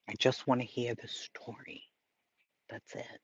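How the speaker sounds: soft unvoiced whisper of a wish. Real, not acted. Streamer whispering to chat, just wanting the story. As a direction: breathe it out, barely voiced. Pleading for story without sidebars.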